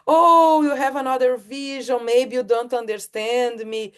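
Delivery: a high, loud, put-upon "oh", then a lightly voiced complaint; mock objection. Speaker voicing a listener who objects, mock offended. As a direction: play the offended listener who feels misunderstood.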